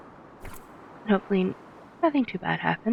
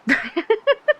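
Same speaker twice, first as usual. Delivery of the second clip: sudden high-pitched giggle; 12 semitones higher, 3 dB louder than usual. Real, not acted. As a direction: burst into a squeaky laugh. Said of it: "I cannot say gobbler with a straight face".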